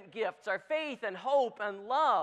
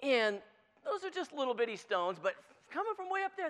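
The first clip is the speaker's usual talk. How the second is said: ordinary talk, nothing marked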